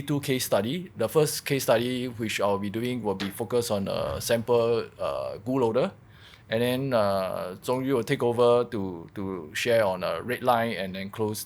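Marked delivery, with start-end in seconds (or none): none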